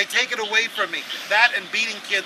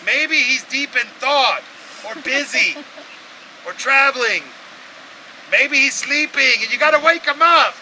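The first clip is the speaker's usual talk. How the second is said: higher, louder mock concern; sarcastic taunt; 6 semitones higher, 7 dB louder than usual. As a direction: tease them about their sleeping god. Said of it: "He's like".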